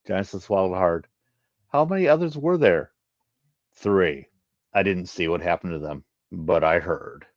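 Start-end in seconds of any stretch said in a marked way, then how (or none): 1.7-2.9 higher, anxious female voice asking; nervous question, pitched up; worried Genesis. Acted. Genesis anxiously asks how many others there were, higher. 3.7-7.3 lower, grave reply; grim male voice. Acted.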